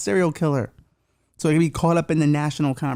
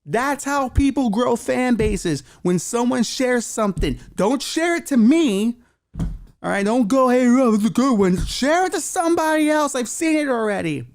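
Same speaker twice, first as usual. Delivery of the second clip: high-pitched exasperated plea; begging; 9 semitones higher than usual. Acted. Host begging fans to share with somebody else, pleading. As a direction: plead high and strained, dragging out key words. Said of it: "Hope that begging didn't bother you".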